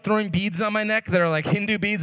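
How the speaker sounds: ordinary talk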